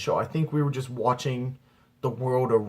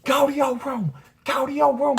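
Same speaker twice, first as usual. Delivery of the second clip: very high, louder mock-mom yelling; screaming angry mom; 4 dB louder than usual. Mom blocking son from kitchen, imitated shrill yelling. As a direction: cut him off in a high maternal shriek. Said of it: "she's screaming and yelling at me".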